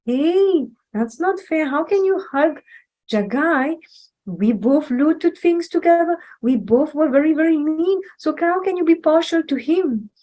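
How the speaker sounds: jealous brother complaining about a hug; indignant complaint. Retold or imitated. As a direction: sulk that it is unfair. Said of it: "Now Madai said that".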